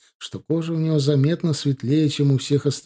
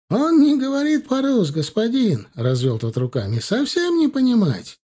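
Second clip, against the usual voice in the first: much higher, broken Russian excuse; pleading merchant; 11 semitones higher than usual. Acted. Merchant insists nephew speaks no Russian, high protest.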